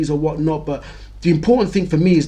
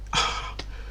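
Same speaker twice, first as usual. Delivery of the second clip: heavy sigh; 11 dB quieter than usual. Acted. Teacher asks dreaded question.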